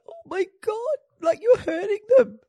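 high, distressed cry at the nurses; loud, high mock distress; upset mother. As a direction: plead for the babies in a high voice. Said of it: "I was like".

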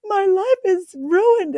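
high, slow, hammed-up wail of despair; mock wailing client. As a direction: imitate a crying client, drawn out and high. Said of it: "they're crying".

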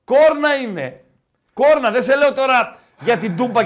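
loud, high-pitched protest; exasperated insistence. Real, not acted. Host correcting co-host about the horn, exasperated loud insistence. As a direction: push back hard, voice jumping up.

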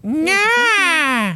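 cat voice. Retold or imitated. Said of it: "he was saying".

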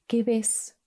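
normal talking voice

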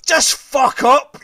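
loud, high, drawn-out snap; exasperated mock shout; exasperated retort. His retort to pestering wife.

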